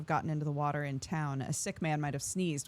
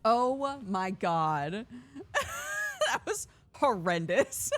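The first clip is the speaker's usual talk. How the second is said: slow, breathy-free laughing through words; 7 semitones higher, much slower than usual. Real, not acted. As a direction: laugh it out in real relief. Laughing relief.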